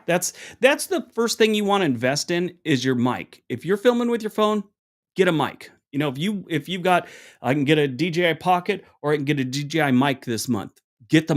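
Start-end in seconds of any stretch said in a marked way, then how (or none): none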